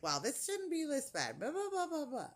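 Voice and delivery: high, singsong blah-blah of the nurse; mocking imitation. Mimics the nurse's dismissive chatter.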